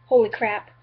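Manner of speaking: loud, delighted holy crap; excited. Real, not acted. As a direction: blurt it out thrilled. Excited about cheap pants.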